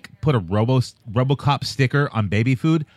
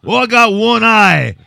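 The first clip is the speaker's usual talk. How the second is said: loud, higher mock-Aussie voice; hammed-up Kano routine; 4 semitones higher, 5 dB louder than usual. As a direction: belt it out like a cartoon brawler.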